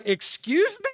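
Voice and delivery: very high indignant question; annoyed. Retold or imitated. Man answers the nosy stall neighbor, high-pitched annoyed disbelief. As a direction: squeak it out in mock affront. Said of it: "I'm kind of annoyed".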